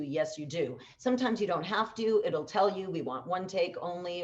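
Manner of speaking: ordinary talk, nothing marked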